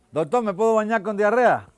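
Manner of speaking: high, loud anxious question; worried patient. Acted. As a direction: ask the doctor, nervous and loud. Joke patient asks doctor.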